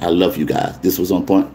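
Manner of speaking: usual speaking voice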